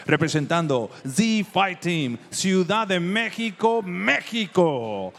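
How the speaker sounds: long, drawn-out fighter name call; lucha libre announcer. Real, not acted. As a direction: stretch the name out like a ring announcer. Ring announcer calling a fighter's name.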